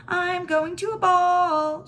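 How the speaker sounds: high, slow, sing-song play voice; high pretend voice. A narrator's reading. Little girl playing dolls, high pretend grand-lady voice. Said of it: "in a high pretend voice".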